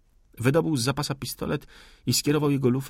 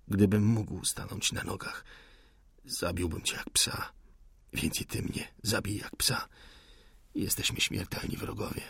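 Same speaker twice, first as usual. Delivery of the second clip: softer, breathy, near whisper; dying enemy's whisper; weak whispered defiance; 6 dB quieter than usual. Acted. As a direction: spit hatred with no strength left. Wounded man defies officer.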